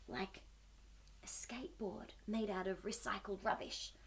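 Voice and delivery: soft, awed whisper; excited whisper. Acted. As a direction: share it like a secret.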